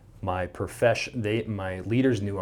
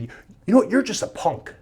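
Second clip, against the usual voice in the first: angry neighbor; 12 semitones higher, 6 dB louder, faster than usual. Retold or imitated. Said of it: "He said".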